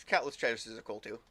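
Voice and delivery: usual speaking voice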